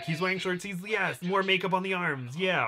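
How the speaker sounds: imitated high-pitched delighted approval; eager excitement. Makeup artist hearing of short sleeves, mock excitement.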